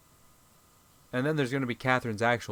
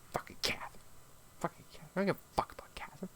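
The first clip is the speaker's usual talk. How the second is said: mock whisper; 7 semitones higher, 12 dB quieter, faster than usual. Mocking the character's words.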